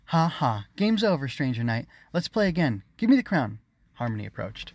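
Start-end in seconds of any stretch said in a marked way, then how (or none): 0.1-3.6 put-on high, louder girlish voice; playful insistence. Queen to usurper, eager and insistent. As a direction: brightly call the game off. Queen demands crown back, game over.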